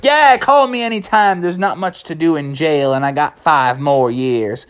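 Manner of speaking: cartoon jailbird starting high; Criminal Kevin. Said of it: "Criminal Kevin".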